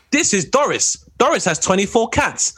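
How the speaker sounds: lightly voiced TV show narrator; mock TV narrator. Comedian imitates a council-house TV show, mock narrator. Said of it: "those shows you watch when it's like".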